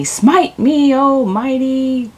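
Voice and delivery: high, taunting cry upward, slowing at the end; Bruce Almighty mocking. Film character daring God to smite him, mocking. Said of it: "and he's mocking".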